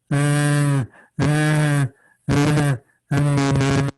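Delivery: wordless monotone mumble; bored droning chant. Shown as a demonstration. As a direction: drone "uh" on one note, utterly bored. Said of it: "it sounds like this".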